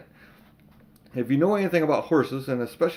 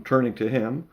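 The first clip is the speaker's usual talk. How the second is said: ordinary delivery, nothing marked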